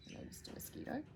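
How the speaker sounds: soft unvoiced whisper to an insect; whispered aside. Real, not acted. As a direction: murmur as if not to disturb it. Aside to a mosquito.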